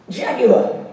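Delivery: a hint of transatlantic ad voice on "Jaguar"; transatlantic accent. Shown as a demonstration. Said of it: "a sort of a transatlantic".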